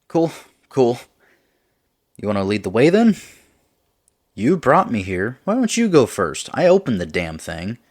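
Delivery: a hint of an annoyed buddy; Max's voice. Max voiced in dialogue.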